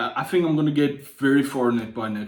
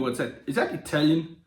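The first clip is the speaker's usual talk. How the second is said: ordinary delivery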